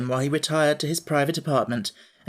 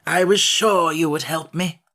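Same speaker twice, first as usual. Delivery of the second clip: slow, louder grateful Scarecrow; pleased; 5 dB louder than usual. Acted. Scarecrow thanking the Emperor, pleased. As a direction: glow with relief. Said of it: "in a pleased voice".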